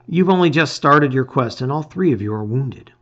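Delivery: ordinary delivery, nothing marked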